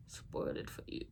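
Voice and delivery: hushed teasing aside; whispered aside. Real, not acted. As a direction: whisper the spoiler warning. Avoiding spoilers.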